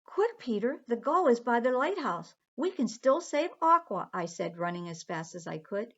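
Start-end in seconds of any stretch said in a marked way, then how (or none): 0.0-4.1 urgent call. Acted. Running to rescue Aqua.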